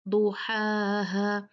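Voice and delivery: slow "दुहाहा" opening on a thick, rounded "दु"; drawn-out "दुहाहा"; heavy rounded daad. Shown as a demonstration. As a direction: round the lips on "दु", then stretch "हाहा". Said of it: "होठों को गोल करेंगे".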